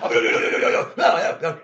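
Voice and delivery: loud, rapid-fire mock scolding; imitated commander's shouting; Don Rickles. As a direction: cut someone off with yelled refusals. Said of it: "pretty much abused us".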